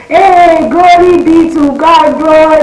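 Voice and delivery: high, loud, joyful praise; a mother celebrating in the market. Retold or imitated. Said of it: "So she was like".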